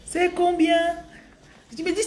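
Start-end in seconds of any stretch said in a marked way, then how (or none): 0.1-0.8 high mock Gabonese accent at market; playful high Gabonese French. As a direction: ask the price in a put-on Gabonese lilt. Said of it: "ils parlent comme les Gabonais".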